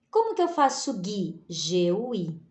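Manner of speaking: ordinary delivery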